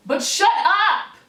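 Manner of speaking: high, loud, drawn-out yell; exasperated shout. Real, not acted. Answering a complaint about children, exasperated shout. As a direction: burst out, cutting the complaint dead.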